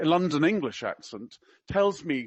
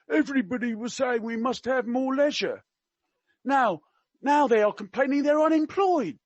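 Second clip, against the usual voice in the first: high mock Cockney, rising and getting louder; attempted Cockney; 8 semitones higher, 4 dB louder than usual. Quoting the prince during a recession, in attempted Cockney. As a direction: build a complaint in put-on Cockney. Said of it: "I can't do Cockney. I will try my best".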